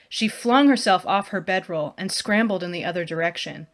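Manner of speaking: usual speaking voice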